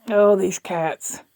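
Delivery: normal talking voice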